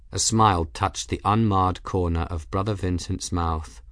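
plain reading voice

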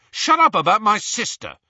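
high, loud angry retort; rough, heated outburst. A narrator's reading. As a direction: snap back roughly in defence of a sister. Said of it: "said Ron roughly".

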